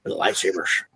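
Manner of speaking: imitated actor. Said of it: "he was saying".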